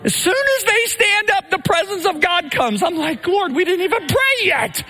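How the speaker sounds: high, lifted voice of sudden awe; awed surprise. Real, not acted. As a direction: caught off guard by the presence, voice leaping up. Sudden presence, awed exclamation.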